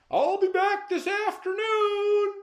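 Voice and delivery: high far-off call across the yard; Mister Man shouting goodbye. A narrator's reading. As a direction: call out loud to his daughter from afar. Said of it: "Mister Man called to his daughter".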